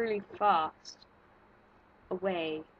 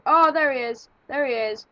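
loud, raised cry of alarm; alarmed shout; 5 semitones higher, 9 dB louder than usual. Real, not acted. Player sees the monster pop up, alarmed shout. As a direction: shout out the sudden sighting.

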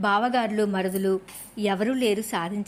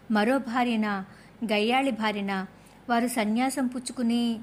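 ordinary delivery

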